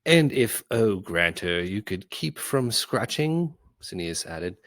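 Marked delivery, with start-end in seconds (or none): 0.0-3.5 fawning flattery. Acted. Cineas flatters Grantor.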